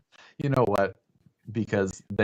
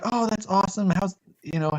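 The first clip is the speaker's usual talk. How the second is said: much higher, eager friend voice; pitched up, bubbly; 12 semitones higher than usual. Retold or imitated. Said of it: "they're like".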